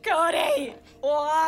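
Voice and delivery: high-pitched, half-laughing protest; exasperated wail. Real, not acted. Co-host demanding an answer from Cody, exasperated wail. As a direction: wail at him in helpless exasperation.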